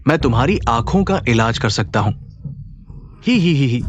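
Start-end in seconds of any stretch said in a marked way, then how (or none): 3.2-3.8 a short sly snicker at the blind man; giggle, pleased with yourself; mocking giggle. Quack snickers at the old man, mocking giggle.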